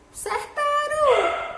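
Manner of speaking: excited praise. Real, not acted. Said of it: "praising the class".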